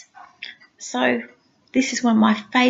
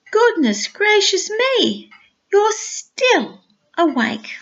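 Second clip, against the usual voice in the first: playful gasp, pitched up; mock surprise; 8 semitones higher, slower than usual. A narrator's reading. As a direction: pretend shock that the little one is awake.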